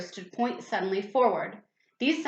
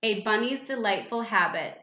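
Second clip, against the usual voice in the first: ordinary delivery, nothing marked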